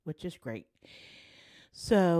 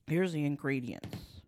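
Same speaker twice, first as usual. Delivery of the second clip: normal talking voice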